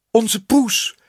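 usual speaking voice

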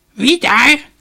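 put-on high, loud, creaky old woman's voice; shrill-pitched storybook crone, full voice. A narrator's reading. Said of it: "riep een krakerige stem".

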